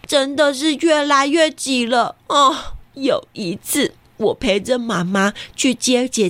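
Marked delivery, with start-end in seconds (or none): none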